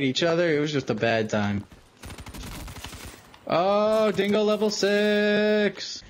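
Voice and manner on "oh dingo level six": high, slow, stretched announcer call; drawn-out hype announcer voice; mock announcer. Announcing dingo level like a caster.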